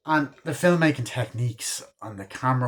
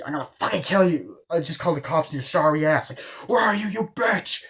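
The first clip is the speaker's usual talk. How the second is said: fast, high, near-crying rage; furious threat; 6 semitones higher than usual. Retold or imitated. Sidney threatening the killer over the phone, enraged. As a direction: spit the threat out, voice cracking higher.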